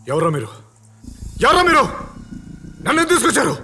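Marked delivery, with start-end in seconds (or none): 1.4-1.8 loud, high-pitched demand; alarmed shout. Acted. Captive shouts at his abductors. 2.8-3.5 high, loud demand for answers; alarmed shout. Acted. Captive shouts at his abductors.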